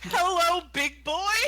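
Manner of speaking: loud flirty hello. Acted. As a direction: call out a loud come-on.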